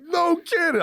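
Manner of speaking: high, amazed "No kidding" with a laugh; stunned disbelief. Retold or imitated. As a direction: gasp it out in disbelief. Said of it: "I didn't say no kidding".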